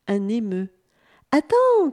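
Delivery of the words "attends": high, loud cry; calling after someone. A narrator's reading. Bunip rushes after the emu, calling out. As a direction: shout to stop someone getting away. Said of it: "cria le bunip en s'élançant".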